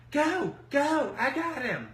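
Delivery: high, loud imitation of her urging; mimicked urgent calling. Rival shutting the door on the storyteller, high urgent mimicry.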